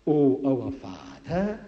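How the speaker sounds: slow, drawn-out invocation; prayer. Retold or imitated. Jesus teaches his people to pray, slow intoned prayer. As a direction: intone it slowly, stretching "Father". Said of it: "Pray like this".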